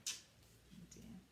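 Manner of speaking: hushed, sympathetic whisper; whispered oh dear. Real, not acted. Reader's aside to child, whispered sympathy.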